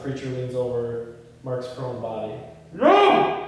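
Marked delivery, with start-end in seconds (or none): 2.7-3.4 sudden high shout of no; anguished scream; desperate cry. Acted. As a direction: cry out in horror. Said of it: "Ray's cries".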